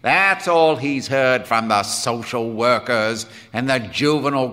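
loud, hard-driving tirade; grim. Acted. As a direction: build to a forceful ultimatum. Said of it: "said Quinn grimly".

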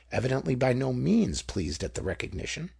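plain reading voice

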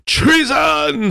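very high cry of outrage; shrill accusing cry. A narrator's reading. Gouty little man echoes the king, crying high. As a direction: cry it out at top pitch.

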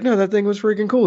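mock excited insistence; imitating enthusiasm. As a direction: push "freaking cool" up high. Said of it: "he was very enthusiastic".

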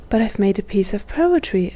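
ordinary delivery, nothing marked

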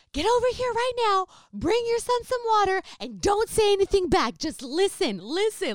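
high, loud crazy-lady yelling; re-enacted screaming. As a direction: bellow orders to cause a big scene, easing slightly at the end. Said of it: "exactly how my brother was seeming to me".

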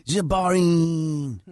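hammed-up fake French accent, slow; goofy French voice, drawn out; mock French accent, stretched slow. Mock French guy talking.

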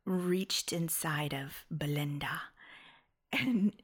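low, slow, breathy stab at a British accent; half-hearted British reading, hushed and halting; barely-there British accent, drawn out almost to a whisper; British accent attempt. Shown as a demonstration. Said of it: "I'm sorry, is this your accent?".

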